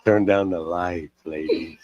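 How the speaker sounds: low, slow late-night radio voice; mock seductive. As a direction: purr it slowly to the ladies. Mock seduction of the listening ladies.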